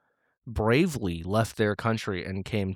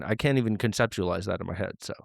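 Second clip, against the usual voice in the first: normal talking voice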